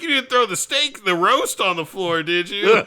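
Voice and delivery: mock horror at the thrown roast; laughing disbelief. Friend retelling the dinner scene, laughing in disbelief. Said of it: "I was like".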